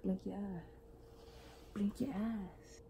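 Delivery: slow whispered dare. Real, not acted. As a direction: breathe it out slowly, voiceless. Face-off game with baby.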